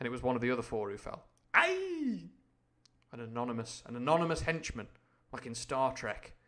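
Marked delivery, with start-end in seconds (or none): 1.5-2.3 sharp high falsetto yelp; high yelp of pain. Acted. Reader voicing the falling henchman, high yelp. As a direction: yelp as the shot hits.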